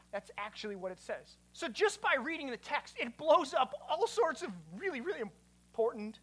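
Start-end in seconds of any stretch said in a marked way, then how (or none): none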